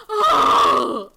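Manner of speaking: sudden loud shocked cry; startled shout. Acted. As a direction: yell as bugs burst out. Bugs burst from the toilet.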